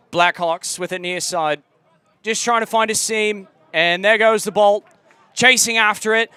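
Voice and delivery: British commentator winding up the call; mock English accent, rising excitement. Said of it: "let it rip".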